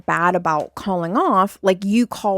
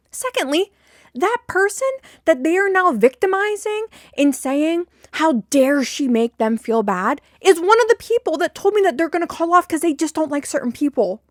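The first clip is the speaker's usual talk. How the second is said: real exasperation; 8 semitones higher than usual. Real, not acted. Venting to viewers about coworkers, indignant and raised. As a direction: fire off the protest in real frustration. Ranting, pitch climbing high.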